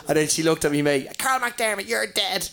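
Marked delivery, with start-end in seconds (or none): 1.2-2.5 high-pitched scolding ma; mock threatening mother. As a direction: imitate mum threatening a boy, pitched up. Mother threatens his mate.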